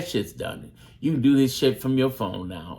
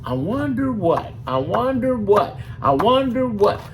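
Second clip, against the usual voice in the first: 1 sarcastic teasing; 8 semitones higher, 4 dB louder than usual. Acted. Teasing answer to mock question.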